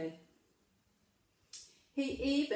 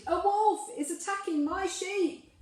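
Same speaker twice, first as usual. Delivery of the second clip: high, loud cry of false alarm; fake panicked shout; 9 semitones higher, 4 dB louder than usual. A narrator's reading. Shepherd boy fakes alarm to the townspeople, shouting high. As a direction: yell it like a faked panic. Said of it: "pretended to be in a panic and shouted".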